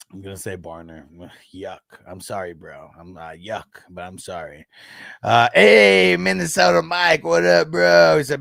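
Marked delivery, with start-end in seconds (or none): 0.1-4.7 reluctant sigh. Real, not acted. Reluctant pick after sigh.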